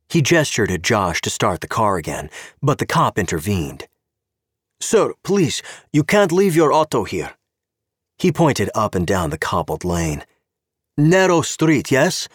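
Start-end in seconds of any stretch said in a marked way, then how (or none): 4.8-12.3 put-on high, accented official voice; a stern Czech cop with broken English; Czech policeman. Czech cop stopping Gabe at the car, politely insistent.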